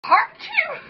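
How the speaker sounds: loud, high, harsh achoo; harsh German sneeze. Shown as a demonstration. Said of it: "You're harsh with it".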